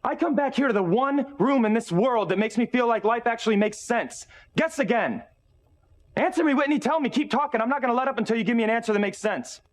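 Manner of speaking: high-pitched, relentless demand; angry yelling. Acted. Father demands an explanation from Whitney, yelling angrily. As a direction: hammer away for an answer. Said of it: "can you just stop yelling at me".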